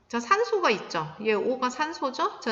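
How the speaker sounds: ordinary talk, nothing marked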